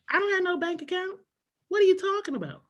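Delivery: put-on high, incredulous kid retort; indignant retort. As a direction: scoff at the saying. Kid retorting to mom's saying.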